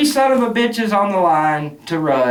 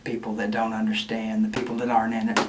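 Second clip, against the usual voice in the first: soft, breathy whisper of scorn; hushed whisper; 11 semitones lower, 11 dB quieter, faster than usual. Real, not acted. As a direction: drop to a sneering whisper. Disdainful remark about outsiders.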